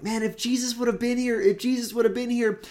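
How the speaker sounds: high lament, the word would stretched out; imagined grieving mourner. Retold or imitated. Mourners at Lazarus's death wishing Jesus had been there, high lament. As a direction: sigh it like a friend who wishes Jesus had come. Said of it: "they're like".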